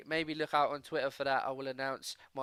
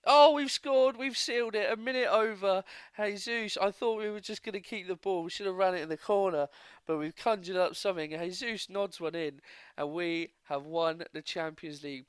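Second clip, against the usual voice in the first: loud, high burst easing into breathless-with-joy commentary; elated goal celebration; 5 semitones higher, 6 dB louder than usual. Real, not acted. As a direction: erupt as the late winner seals the final. Celebrates late goal sealing the final.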